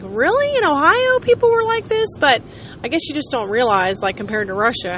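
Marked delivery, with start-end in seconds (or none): 0.0-2.1 high, disbelieving question; incredulous surprise. Retold or imitated. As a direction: act stunned that Ohio people are this friendly. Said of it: "and I was like".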